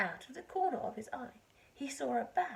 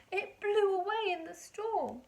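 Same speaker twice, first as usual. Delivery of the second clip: higher, louder goat lamenting its sail; sad goat voice; 6 semitones higher, 4 dB louder than usual. Acted. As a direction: play the goat mourning its sail, pitched up.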